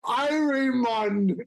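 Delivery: slow, drawn-out Jamaican greeting; Jamaican accent. Acted. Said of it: "my best Jamaican accent".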